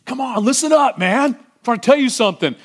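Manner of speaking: high, loud, impatient call for attention. Retold or imitated. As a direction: demand to be heard. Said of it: "They got louder".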